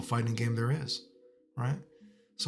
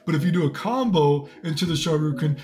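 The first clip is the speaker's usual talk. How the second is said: higher, smug know-it-all voice with laughs; mocking nerdy robot developer; 4 semitones higher than usual. Streamer mocks Street Fighter six designers, higher smug nerd voice. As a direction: play the game dev as a smug nerd showing off. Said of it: "the robot added in stuff like this to be like".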